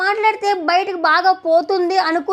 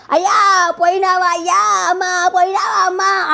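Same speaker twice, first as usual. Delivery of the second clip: mock funeral wailing; 3 semitones higher than usual. Reviewer mocking a housemate crying like at a funeral, mock wailing. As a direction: ham up wailing over the dead. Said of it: "అన్నట్టు ఏడుస్తుంది".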